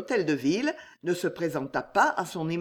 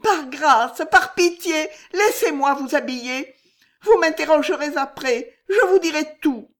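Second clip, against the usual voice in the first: much higher, louder urgent begging; desperate pleading; 9 semitones higher, 7 dB louder than usual. A narrator's reading. Maid Juliette begging her mistress to dress, desperate. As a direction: beg her for mercy against the clock. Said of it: "regard désespéré".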